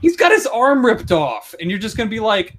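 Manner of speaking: high-pitched disbelief; worked up over sheer absurdity; incredulous rant. Real, not acted. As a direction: rant in exasperated, mocking disbelief. Incredulous rant mocking the oblivious girl.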